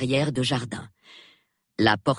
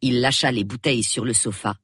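ordinary talk, nothing marked

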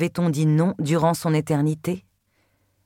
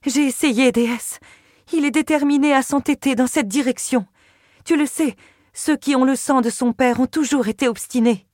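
Pénélope, pleading; 7 semitones higher than usual. A narrator's reading. Narrator voicing Pénélope.

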